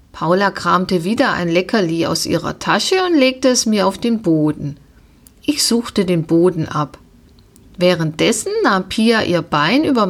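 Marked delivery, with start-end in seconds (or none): none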